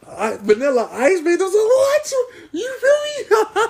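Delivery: fake-sob it like a heartbroken fan, cracking into laughter; mock wailing. Fake breakdown over the turtles.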